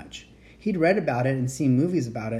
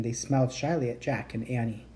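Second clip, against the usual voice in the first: usual speaking voice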